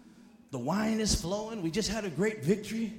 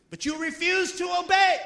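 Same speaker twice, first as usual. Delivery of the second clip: higher, louder stern rebuke; prophet's rebuke; 9 semitones higher, 5 dB louder than usual. Retold or imitated. Prophet rebuking King Saul, stern and loud. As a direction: hit him with the verdict. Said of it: "Samuel turned to Saul and he said".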